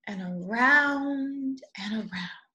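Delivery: whisper. A narrator's reading. Whispered round and round.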